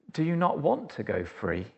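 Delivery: quiet guard's question. Acted. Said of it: "and goes".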